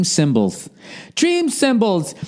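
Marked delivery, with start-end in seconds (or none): 1.1-2.1 much higher, slow, put-on announcer voice; high, drawn-out mock commercial read. Shown as a demonstration. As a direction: sell the brand name like a radio ad. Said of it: "start the advertising with a free read".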